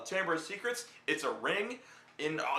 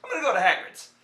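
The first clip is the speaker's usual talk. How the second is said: higher, louder cheerful announcement; mock Harry; 6 semitones higher, 6 dB louder, faster than usual. Lucky Harry announcing his plan, cheerful and loud. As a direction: blurt it out like he's tipsy. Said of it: "Harry's like".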